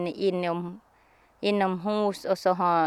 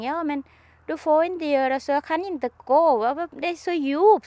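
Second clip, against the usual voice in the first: higher, mildly raised protest; retold yell; 7 semitones higher than usual. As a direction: protest that you cannot walk. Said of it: "jag skrek". (upprört högre rop, lätt spelat)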